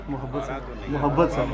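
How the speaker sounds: ordinary talk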